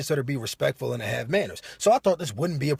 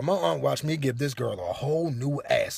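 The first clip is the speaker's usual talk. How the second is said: ordinary talk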